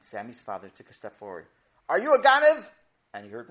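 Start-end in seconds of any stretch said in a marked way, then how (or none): 1.9-2.7 high, loud demand; challenging call. Acted. Father challenging the thief in the can, loud and high. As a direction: call it out at a suspect.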